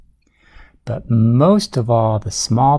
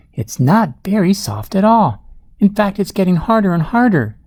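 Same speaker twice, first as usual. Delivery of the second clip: high, rising storybook worry; alarmed pig; 8 semitones higher than usual. A narrator's reading. Small pig feels the mud hardening, alarmed. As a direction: pitch it up and hurry toward the end.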